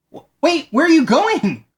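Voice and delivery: high, startled call after the boat; alarmed call after him. Retold or imitated. You call after the boatman who took your money, startled. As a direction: stop him before he leaves with your money.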